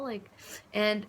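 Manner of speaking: usual speaking voice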